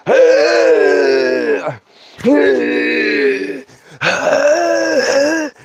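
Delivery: choking gasps. Retold or imitated. Kid gasping after a gulp of moonshine, strangled cries. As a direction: gasp out like your throat is on fire.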